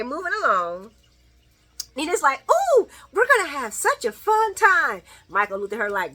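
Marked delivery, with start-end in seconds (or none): 2.5-5.0 imitating Nina. Voicing Nina's eager line.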